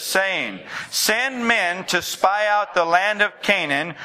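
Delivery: slow, measured congregational reading; scripture read-aloud. Real, not acted. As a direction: lead everyone reading the verse in unison, unhurried. Leads congregation reading scripture together.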